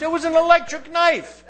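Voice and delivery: high, put-on voice of a guilty man; mock defendant's lame excuse. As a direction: play the lame excuse innocently.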